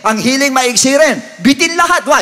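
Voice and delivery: ordinary talk, nothing marked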